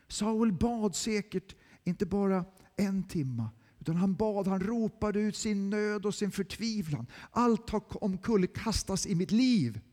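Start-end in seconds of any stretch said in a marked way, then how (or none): none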